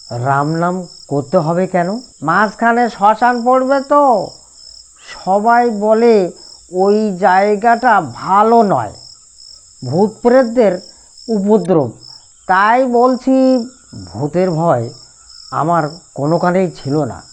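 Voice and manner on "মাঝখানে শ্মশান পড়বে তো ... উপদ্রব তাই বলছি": higher, slow nasal whine with a hint of dread; nasal whiny warning. A narrator's reading. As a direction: warn him slowly, high and whiny. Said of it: "তিলক খনখনি গলায় উত্তর দিল". (ধীরে, খনখনে গলায় সাবধান করা)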